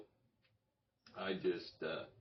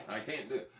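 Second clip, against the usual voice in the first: ordinary talk, nothing marked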